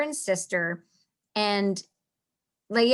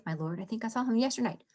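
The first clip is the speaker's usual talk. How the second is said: fast, softer run through the line; quick delivery; 5 dB quieter, much faster than usual. Shown as a demonstration. Teacher showing the class the line's speed, rushed. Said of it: "the speed at which they are to deliver the lines".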